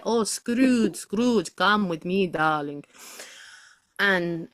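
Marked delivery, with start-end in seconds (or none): 0.0-2.8 put-on theatrical Russian accent, calling Scrooge along. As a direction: play a Russian character beckoning someone. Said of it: "a Russian, be like".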